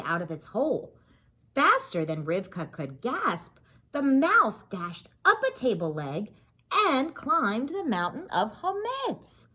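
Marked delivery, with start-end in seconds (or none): none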